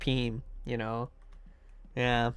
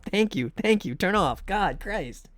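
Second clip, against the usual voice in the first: normal talking voice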